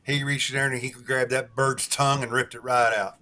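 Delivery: usual speaking voice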